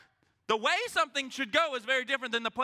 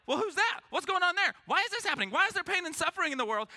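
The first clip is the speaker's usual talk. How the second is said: baffled questions; 8 semitones higher, faster than usual. Retold or imitated. Congregation voiced asking confused questions.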